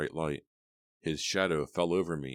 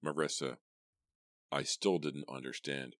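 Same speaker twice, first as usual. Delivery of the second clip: plain reading voice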